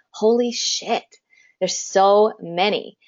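half-whispered retold disbelief, slowing down; imitated awed reaction, drawn out; hushed astonishment. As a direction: relive being stunned, under your breath. Retold stunned reaction at workshop.

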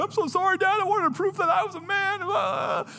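put-on high, breaking sob of a boy caught out; crying apology. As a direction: cry it out to your dad, voice cracking high. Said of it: "and I started crying".

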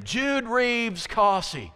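"Jude Reeves Cossey" said slowly, each name weighted; full name, emphatic. Shown as a demonstration. Parent calling a child by full name, slow and weighted. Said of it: "you might say their full name".

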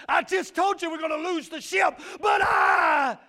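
loud, rising preacher's cry; preaching at full force. Acted. Preacher to his congregation, loud, fervent preaching. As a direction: preach it hard over the church.

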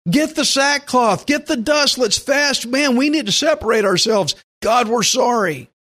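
high, urgent call to fast and mourn; rally the people to repent; urgent repentance. Pretend. Israelites rallying each other to repent, high and urgent.

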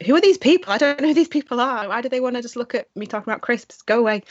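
high, loud flustered outburst, then rushing; mock panic at strangers watching her; exasperated. Telling a friend how fans overwhelmed her, high and flustered. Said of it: "And I'm like".

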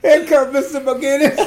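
high, loud, giggling through the boss's name; father's forced delighted laugh at the boss's arrival; laughing greeting. Retold or imitated. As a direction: bubble over with nervous laughter as the boss drives up. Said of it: "he laugh like this".

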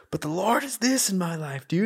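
high, pressed quote of a heated argument; mock heated argument. Recalling a shouting match with Nick, voiced high and strained. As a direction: replay the fight in a pinched, strained voice. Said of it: "we were in Israel yelling at each other".

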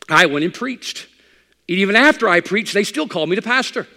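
high, loud pulpit voice; full-force preacher; fiery preaching. Acted. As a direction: preach with rising fire.

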